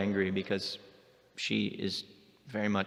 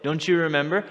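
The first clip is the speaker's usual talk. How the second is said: boastful Krishna; 7 semitones higher, 4 dB louder than usual. Retold or imitated.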